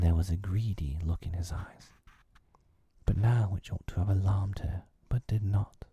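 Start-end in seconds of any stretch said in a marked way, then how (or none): none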